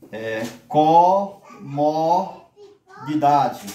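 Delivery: comodidade slowly split co-mo-di-da-de; syllable by syllable. Shown as a demonstration. Teacher to class, counting the syllables of comodidade, slow. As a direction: tap out each syllable of comodidade. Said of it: "palavra que tem cinco sílabas".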